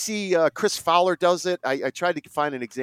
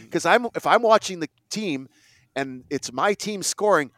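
ordinary talk, nothing marked

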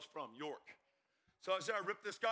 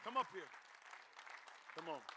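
raised beckoning call; higher-pitched, urging invitation; calling someone up; 5 semitones higher than usual. Real, not acted. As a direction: coax her up from the crowd. Calls his wife up on stage.

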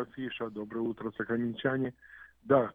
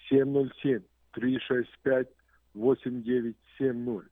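slow, spelled-out phone number; slow number read; slower than usual. Shown as a demonstration. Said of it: "number read slowly".

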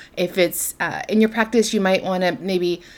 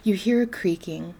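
softer, lower-key meditation voice with long pauses; guided meditation; 3 semitones lower, 7 dB quieter than usual. Acted. Host leading listeners into darkness and quiet, guided meditation. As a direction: guide them gently into calm, leaving space between phrases.

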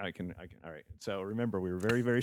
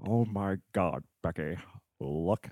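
very slow, lower, softer menacing villain drawl; Alan Rickman as Hans Gruber; 4 semitones lower, 6 dB quieter, slower than usual. Shown as a demonstration. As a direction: draw it out like Hans Gruber savoring each word. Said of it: "Alan Rickman as Hans Gruber".

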